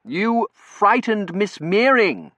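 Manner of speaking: deliberate finger-wagging reprimand; stern. A narrator's reading. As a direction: tell the dog off firmly. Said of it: "he said sternly and shook his finger".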